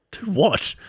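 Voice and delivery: high, startled do what; caught-off-guard high question; baffled do what. Retold or imitated. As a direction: pitch it up high in confusion. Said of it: "I was like".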